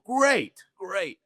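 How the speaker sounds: jumps high and loud on "Great", then settles; eager yes. Retold or imitated. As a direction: gleefully accept the danger. Eager reply to broken bones.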